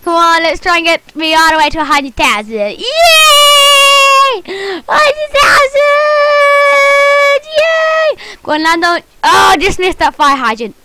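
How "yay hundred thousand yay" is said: excited milestone cheer. Real, not acted. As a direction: shout the number with joy.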